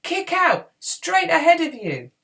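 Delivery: high, loud urgent command; shouting. A narrator's reading. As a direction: shout directions in the heat of battle. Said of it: "Podkin shouted".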